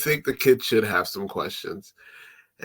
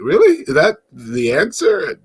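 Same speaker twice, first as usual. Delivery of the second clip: much higher and louder on Really, in disbelief; incredulous; 5 semitones higher, 5 dB louder than usual. Retold or imitated. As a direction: scoff at the weak answer. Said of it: "And I'm like".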